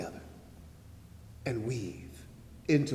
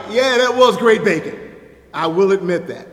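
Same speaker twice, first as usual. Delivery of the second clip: emphatic retort; 6 semitones higher, 13 dB louder than usual. Retold or imitated. Retorts to brother about bacon.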